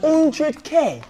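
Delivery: high, loud disbelief; incredulous. Real, not acted. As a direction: blurt it back in real shock. Host taken aback by salary demand.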